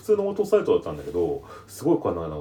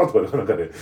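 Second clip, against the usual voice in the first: usual speaking voice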